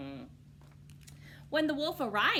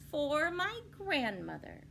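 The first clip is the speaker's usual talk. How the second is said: drawn out slowly; unhurried, careful words; 10 semitones higher, slower than usual. A narrator's reading. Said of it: "said slowly".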